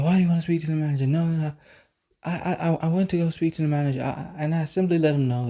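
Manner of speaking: high, rapid mocking imitation; egotistical employee. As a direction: rattle it off as an affronted clerk. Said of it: "the dude has such an ego".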